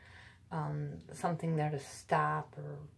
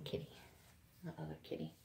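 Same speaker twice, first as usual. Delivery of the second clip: whispering; 15 dB quieter, faster than usual. Real, not acted. Aside to the cat.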